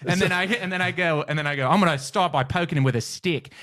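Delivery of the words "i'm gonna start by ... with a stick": raised-pitch Australian wildlife commentary; comic nature-show Australian; Steve Irwin. Retold or imitated. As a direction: announce the plan as an eager Australian host. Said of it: "I would be Steve Irwin".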